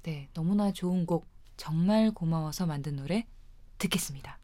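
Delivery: low hushed intro, fading to a whisper; soft DJ outro. Acted. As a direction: ease into the song softly. DJ softly introduces the song.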